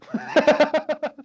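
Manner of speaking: high, loud laugh; high burst of laughter. Real, not acted. Boxer laughing at his sauna story, high loud laugh. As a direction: laugh out at the memory.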